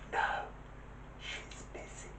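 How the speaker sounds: put-on tiny hushed child on the phone; little whispered voice. Little child answering a caller, whispering. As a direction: whisper it softly, like a secretive little kid. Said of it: "a little voice answered".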